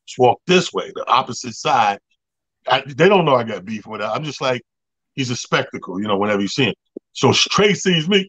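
ordinary delivery

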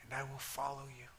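soft, slow prayer voice; hushed prayer; quiet prayer. Acted. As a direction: pray gently with the congregation. Leads salvation prayer.

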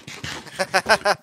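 loud burst of real laughter; amused laugh. Real, not acted. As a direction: crack up at your own silly wording. Laughs at own word slip.

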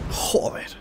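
whispered swear; cursing under breath. Real, not acted. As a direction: hiss the curse softly. Whispered curse at bad luck.

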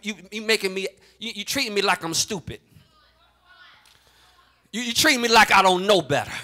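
usual speaking voice

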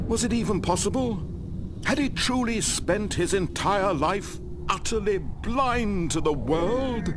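high-pitched, impassioned narration of disbelief; dramatic disbelief. A narrator's reading. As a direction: narrate with mounting incredulity. Story's hero refuses horrible truth.

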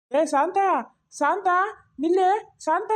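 high, rising calls to someone ahead; calling out. Acted. Man calls to his wife walking off, calling out. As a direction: hail her from down the road, pitched well up. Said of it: "ಮಾವ, ಕರೀರಿ".

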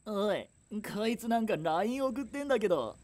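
high, sneering bully voice, soft and distant; mocking bully. Bully mocks the girl texting for help, high sneer. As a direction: jeer like a schoolyard bully.

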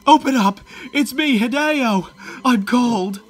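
high, loud desperate calls; desperate shout. Acted. Friend at the window in the storm, shouting to be let in. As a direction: yell to be let in.

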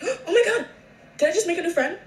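high, loud, delighted; thrilled to meet a new friend; excited squeal. Real, not acted. Dog approaching her.

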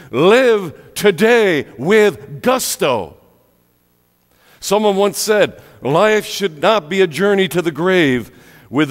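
high, loud, emphatic exhortation; full-force preaching. Acted. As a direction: urge the congregation with punched key words.

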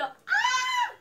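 high, sharp scream; startled scream. Retold or imitated. Dreamer turns round and sees [name], startled scream. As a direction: shriek as if caught by surprise. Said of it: "yo grito".

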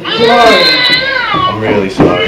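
very high, very slow hammed-up wail; [name] wailing his apology to the interviewers; wailing sob. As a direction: stretch it out into a comic blubbering cry.

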